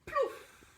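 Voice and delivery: high loud plouf; cartoon splash; big splash. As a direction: make the splash land big. Log falls into lake.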